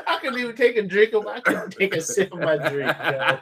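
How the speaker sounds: words shaken by laughter; real helpless laughing speech. Real, not acted. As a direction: talk through a fit of giggles. Laughing too hard to drink.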